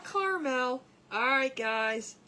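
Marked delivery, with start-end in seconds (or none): 0.0-0.8 caramel as CAR-mel, middle A dropped; two-syllable caramel. Shown as a demonstration. Host correcting viewers, caramel said as CAR-mel. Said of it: "It's not caramel".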